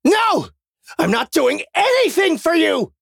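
very high, loud shout; furious refusal. A narrator's reading. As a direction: refuse outright at the top of the voice.

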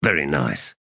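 low, falling polite approval; VERY NICE said low with little enthusiasm; unimpressed praise. A narrator's reading.

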